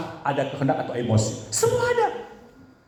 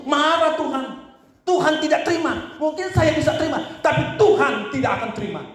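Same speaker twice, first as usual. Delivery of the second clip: fervent preaching; 11 semitones higher than usual. Acted. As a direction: preach it at full force, pitch raised. Pastor rising to full preaching.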